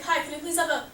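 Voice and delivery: small, slightly high put-on voice. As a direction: mumble a drive-through order as a joke. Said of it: "please, like, speak up or leave".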